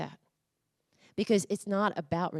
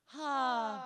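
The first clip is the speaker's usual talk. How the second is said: big open exhaled haaa; 5 semitones higher than usual. Shown as a demonstration. Volunteer gives the room a big, open ha. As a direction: let the breath out in a long, high ha. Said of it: "Give 'em a big ha".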